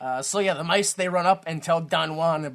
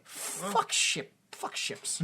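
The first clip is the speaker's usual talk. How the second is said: frustrated mutter; 6 dB quieter, much slower than usual. Real, not acted. Mock frustration at nautical terms.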